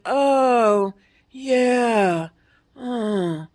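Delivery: vacant, trailing "oh, yeah, oh"; mock blank stare. Mimicking the confused Chick-fil-A drive-through worker, vacant and trailing off.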